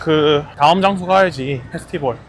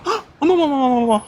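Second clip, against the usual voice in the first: high, loud overreacting gasps and cries; exaggerated shock; 11 semitones higher, 4 dB louder, faster than usual. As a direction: ham up a startled auntie. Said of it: "항상".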